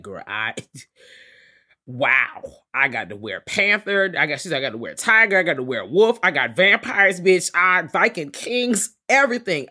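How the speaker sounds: loud thrilled boasting; gushing Sookie. Retold or imitated. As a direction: brag it out loud like she can't believe her luck. Gushing Sookie quoted in the book.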